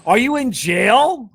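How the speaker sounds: high, loud, amazed question; surprised. Real, not acted. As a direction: gasp it out in disbelief. Sees bars on video call.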